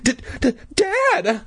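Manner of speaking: high-pitched stuttered cry for Dad; stammering. A narrator's reading. Scared child calling to Dad, stammering. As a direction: stumble on the first sound, then burst out high. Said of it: "I managed to stammer".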